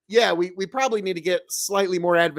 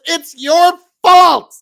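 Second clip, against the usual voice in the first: high, loud, drawn-out imitated yell; mimicked angry heckle from the crowd; heckler's yell; 10 semitones higher, 11 dB louder, much slower than usual. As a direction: shout it like a heckler across the room. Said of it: "somebody yells".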